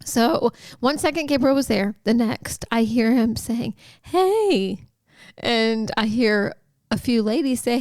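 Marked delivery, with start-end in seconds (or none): 4.0-4.9 put-on high, bright little-boy hey; child's greeting. Son calling hello into the back room, high childlike. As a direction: call out like a small boy.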